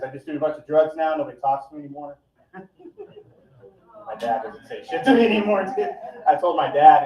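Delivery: ordinary talk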